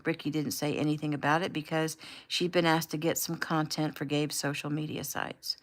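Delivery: usual speaking voice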